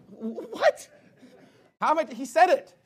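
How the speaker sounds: high, loud incredulous what; real outrage at the charge; exasperated preacher; indignant disbelief. Real, not acted. Indignant reply to theologian.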